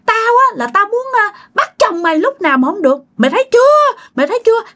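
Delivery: very high, taunting spirit voice; eerie high-pitched mock triumph; possessed gloating. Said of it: "Bắt đầu cười hả hả hả".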